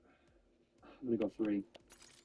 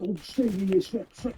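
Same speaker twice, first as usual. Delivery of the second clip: put-on higher, thick British accent on shit; 6 semitones higher than usual. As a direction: imitate the radio host in broad British. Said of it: "That's where the British thing came from".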